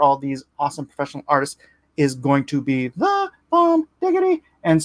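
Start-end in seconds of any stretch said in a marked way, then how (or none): 2.9-4.4 hyped-up squeal. Acted. Hyping his own cards.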